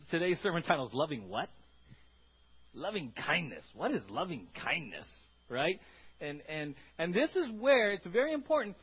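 ordinary talk

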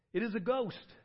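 the disciples shrieking at a ghost; cried out in fear. A narrator's reading. Disciples seeing Jesus on the lake, crying out in fear. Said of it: "cried out in fear".

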